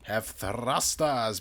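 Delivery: ordinary talk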